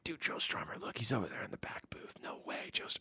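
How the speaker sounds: imitated background whispering; very soft, voiceless aside between friends; hushed whisper. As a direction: whisper it like gossip at the next table. Overheard background gossip retold.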